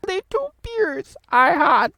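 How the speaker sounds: very high, loud creature voice; tipsy little sage, high-pitched; Yoda. Acted. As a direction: protest in a squeaky parody alien voice. Yoda denies being drunk.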